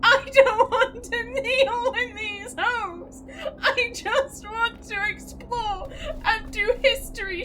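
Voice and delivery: high, slow, whiny fake sob; mock distressed wail. Real, not acted. Player whining at the game, mock crying. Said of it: "I'm so distressed".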